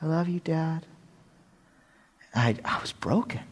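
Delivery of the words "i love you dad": put-on little boy voice. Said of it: "his little voice".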